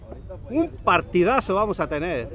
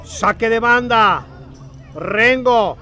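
louder and higher, building urgency; excited play-by-play; 6 semitones higher, 9 dB louder than usual. Real, not acted. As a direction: warn of the danger, building. Live play call, rising.

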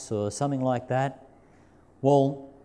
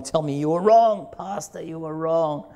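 lightly voiced child, a touch higher; cheeky kid; 4 semitones higher, 3 dB louder than usual. Children gloating at their pastor, mild cheeky voice. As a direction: tease the pastor like a smug kid.

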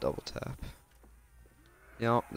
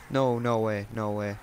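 panicked gasp; 7 dB louder, slower than usual. Real, not acted. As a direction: gasp then frantic, louder protest. Nearly downed in game.

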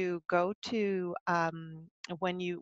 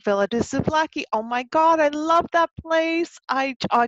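excited; 8 semitones higher, 6 dB louder than usual. Real, not acted. Delighted at favourite restaurant winner.

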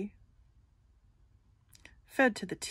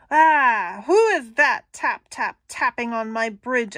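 troll voice; 2 semitones higher, 8 dB louder than usual. Acted. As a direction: boom it out from under the bridge. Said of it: "Oh, that voice, like a tornado".

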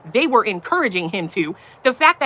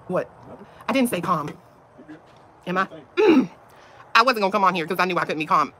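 ordinary talk, nothing marked

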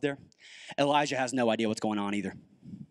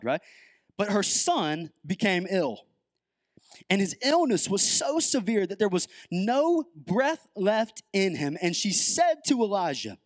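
scripture reading; 4 semitones higher than usual. Acted. Reads the scripture aloud.

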